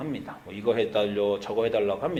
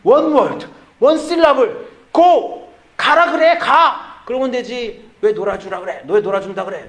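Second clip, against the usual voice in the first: put-on much higher, louder indignant girl's voice; upset daughter; 9 semitones higher, 8 dB louder than usual. Sweet second daughter scolding dad for playing with her brother. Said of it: "그 말이 너무 웃겨요".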